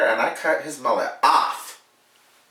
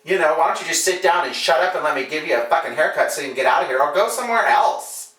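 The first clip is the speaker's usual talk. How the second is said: telling him off; 4 semitones higher than usual. Retold or imitated. Barber snapping back at the rude customer, heated. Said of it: "I turned around and looked at him and I said".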